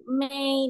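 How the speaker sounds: "May" high and level; first-tone demo. Shown as a demonstration. Teacher showing the high tone, level "May". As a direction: hold "May" up on a steady note. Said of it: "dia tinggi nadanya".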